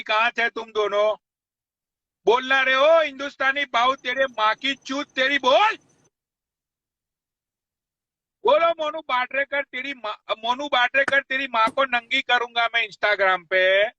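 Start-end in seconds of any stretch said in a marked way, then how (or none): 2.3-13.9 real anger, pitched high; angry challenge. Real, not acted. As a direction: call the rival out in a raised, furious voice. Enraged live rant, challenging a rival.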